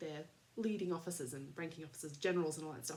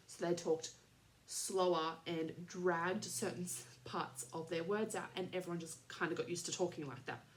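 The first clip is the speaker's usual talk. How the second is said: normal talking voice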